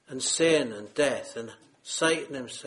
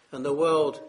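ordinary delivery

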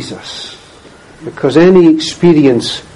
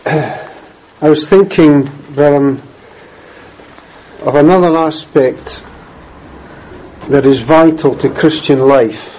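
usual speaking voice

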